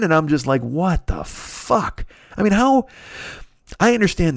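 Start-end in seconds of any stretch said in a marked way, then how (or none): 0.6-2.9 very slow, drawn-out bafflement; baffled disbelief. Retold or imitated. Comic reacting to Artie's adoring fans, baffled disbelief. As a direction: stretch each word out, stunned.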